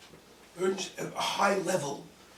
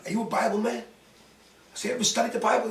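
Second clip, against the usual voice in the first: challenging; 4 semitones higher, 6 dB louder than usual. Retold or imitated. Challenging the doctor in quarrel.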